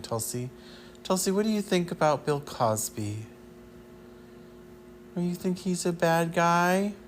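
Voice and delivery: talking to the dog. Pretend. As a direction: coo at the dog like a talk show host. Talking to her dog Tosi.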